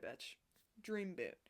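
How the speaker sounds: normal talking voice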